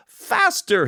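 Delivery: co-star mocking the kid in front of the crew; mocking repeat. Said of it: "he says".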